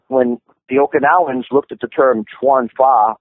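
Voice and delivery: ordinary talk, nothing marked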